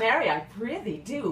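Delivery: laughing. Acted. Laughing through a teasing line.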